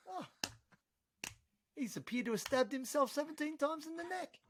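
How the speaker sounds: high, mock-casual cop reporting in; high cop voice shrugging off a stabbing. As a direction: play a bored officer calling it in.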